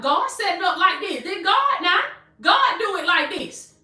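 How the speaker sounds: high-pitched, emphatic preacher exclamation; impassioned, lifted preaching voice; fired-up preaching. Real, not acted. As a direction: exclaim it to the congregation. Preaches at full force.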